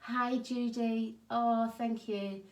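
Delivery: ordinary talk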